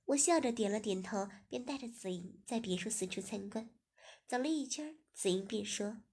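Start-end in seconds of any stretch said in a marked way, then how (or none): none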